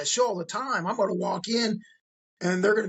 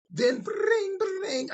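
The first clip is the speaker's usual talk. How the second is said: much higher ringing bring bring; phone ring; much slower than usual. Retold or imitated. Phone ringing during the story, mimicked much higher.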